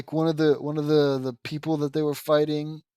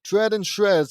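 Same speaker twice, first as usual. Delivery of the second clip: battle cry; 6 semitones higher, 7 dB louder than usual. Acted.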